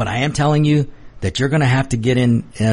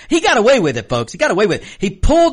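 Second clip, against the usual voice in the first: indignant; 7 semitones higher, faster than usual. Real, not acted. Indignant rant to audience.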